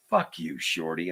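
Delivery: low, quiet deadpan curse; mock Eastwood brush-off; Clint Eastwood. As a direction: growl it low and soft without heat. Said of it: "he looked at me and he says".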